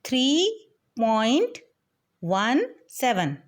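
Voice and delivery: Three point वन seven spaced out and slow; slow teacher demo of three point वन seven; slow demo reading. Shown as a demonstration. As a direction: read each part slowly, like dictating. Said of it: "इसे हम बोलेंगे".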